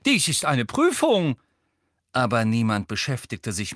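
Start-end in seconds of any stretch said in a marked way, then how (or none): 0.1-1.4 high, loud scandalised professor; affronted old examiner's protest; indignant professor. A narrator's reading. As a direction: scold in an outraged tone, pitched well up. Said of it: "in empörtem Ton".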